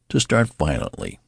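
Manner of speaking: plain reading voice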